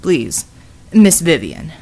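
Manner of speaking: low, formal big man; courteous low plea; deep polite voice. Acted.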